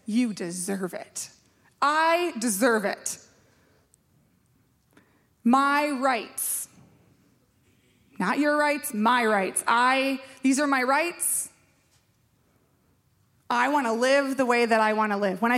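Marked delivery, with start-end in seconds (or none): 0.1-11.5 slow, lightly mocking self-centred voice; hammed-up me-first attitude, drawn out; mock entitled chant of my rights; mock entitled whine. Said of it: "you say things like".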